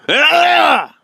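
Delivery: loud high-pitched wordless yell; mocking shout. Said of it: "Why are you shouting at me?".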